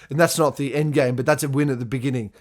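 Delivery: normal talking voice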